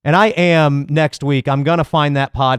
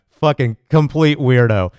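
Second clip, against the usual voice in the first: ordinary delivery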